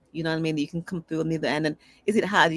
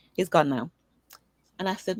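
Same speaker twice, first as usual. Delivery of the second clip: ordinary delivery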